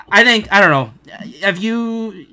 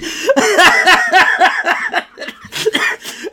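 a long, rising, high cackle; long high laugh; 7 dB louder than usual. Real, not acted. Fan losing it at a comic scene, long high laugh. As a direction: dissolve into laughter. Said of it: "I'm laughing right now talking about it".

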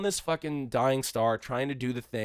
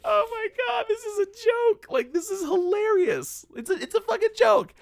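pitched way up, slowed, laughing disbelief; incredulous high shriek; 3 dB louder than usual. Retold or imitated. Reacting to the Dinklage scene, a high incredulous squeal. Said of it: "And I was just like".

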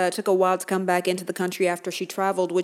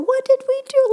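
much higher, louder indignant repeat; bigger outcry; 6 dB louder than usual. Acted. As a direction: push the outrage further.

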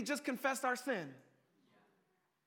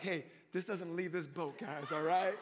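low, joking pact among friends; conspiratorial aside; 7 semitones lower than usual. Acted. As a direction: propose it low, half-amused. Disciples' secret pact acted jokingly.

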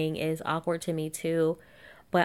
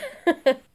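loud high laugh; 11 semitones higher, 7 dB louder than usual. Real, not acted. Laughing at Darren.

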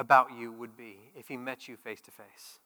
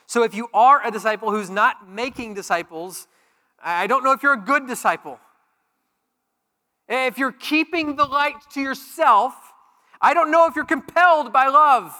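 rising, louder preacher's challenge; preaching at full force; 8 semitones higher, 10 dB louder than usual. Acted. Pastor challenging the church, preaching loud. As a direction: press the point home, climbing in pitch.

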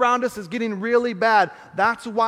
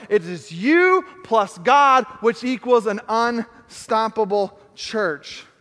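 slow, louder, words hammered; emphatic slow climax; 3 dB louder, slower than usual. Shown as a demonstration. Pastor hammers the equation home, slow and emphatic. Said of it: "add to the equation".

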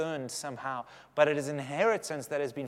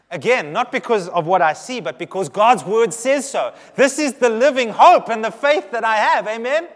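preaching climax; 7 semitones higher, 12 dB louder than usual. Real, not acted.

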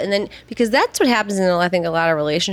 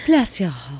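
lower, slow Southern "Bless your heart"; Southern grandma accent; 4 semitones lower, slower than usual. Retold or imitated. Granddaughter quoting her grandma's favourite phrase, in a Southern accent. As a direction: drawl it like a Georgia grandma. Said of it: "They speak so slow and sweet".

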